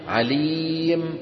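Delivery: slow chanted عليم with the final n dropped; pausal form. Shown as a demonstration. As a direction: stop on عليم, stretched like recitation. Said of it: "كيف نقف على عليم".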